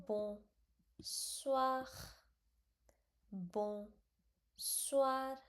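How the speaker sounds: "bonsoir" stretched long as "bon-swa"; drawn-out bonsoir. Shown as a demonstration. Teacher modelling the word slowly. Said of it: "I'm not saying bonsoir".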